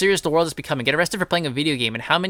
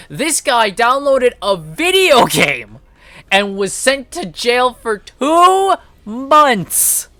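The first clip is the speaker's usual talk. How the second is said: slow, higher, heavily stressed protest; incredulous outrage; 7 semitones higher, 4 dB louder, much slower than usual. Real, not acted. Streamer protesting jail for a downloaded game, incredulous outrage. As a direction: draw the words out in exasperated disbelief. Outraged comparison with lighter sentences.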